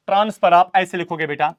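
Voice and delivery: normal talking voice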